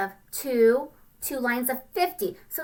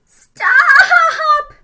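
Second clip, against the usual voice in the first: shout; 14 dB louder than usual. Acted. Littlest ant halts the line.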